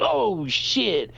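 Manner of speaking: high-pitched oops reaction; mock dismay. Someone who just broke a glass, dismayed. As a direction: imitate someone caught by an accident. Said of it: "where you break a glass".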